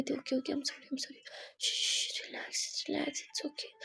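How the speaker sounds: soft hushed comfort, almost breath only; calm her down in a whisper; whispering to a crying friend to calm her; soothing whisper. Acted. Kiran soothing crying Simran.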